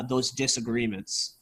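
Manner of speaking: ordinary talk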